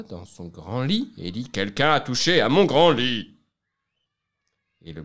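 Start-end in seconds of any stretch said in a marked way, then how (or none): 1.5-3.4 big bear's voice. Acted. Big bear voiced in story.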